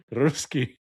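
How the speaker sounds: "Ruski" with a rolled R at the start. Shown as a demonstration. Co-host trying out the advice, rolling the R in "Ruski". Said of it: "You gotta roll your R's".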